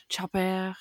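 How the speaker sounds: soft imitated French accent on "Chopper". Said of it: "say chopper in a French accent".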